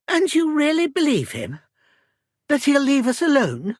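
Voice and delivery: high-pitched, pressing disbelief; incredulous questioning. Acted. Mother challenging Henry's trust in a threat, incredulous. Doubts that they are safe.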